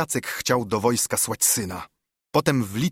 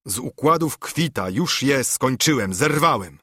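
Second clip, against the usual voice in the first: plain reading voice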